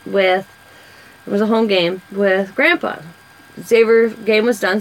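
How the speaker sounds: normal talking voice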